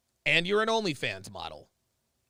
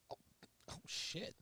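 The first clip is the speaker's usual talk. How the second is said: hushed realization; 20 dB quieter than usual. Real, not acted. Realizing something on stream.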